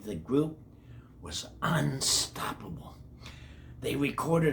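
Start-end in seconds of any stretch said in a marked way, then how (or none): 1.2-3.0 breathy whisper, building suspense; dramatic whisper. Acted. Teacher whispers to the kids, building suspense. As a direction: whisper it like a big secret.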